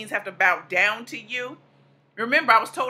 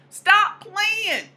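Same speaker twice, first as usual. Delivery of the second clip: higher sassy echo; higher repeat; 8 semitones higher than usual. Shown as a demonstration. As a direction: Stop said much higher and playful.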